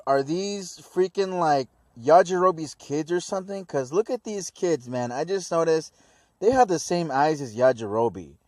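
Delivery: ordinary delivery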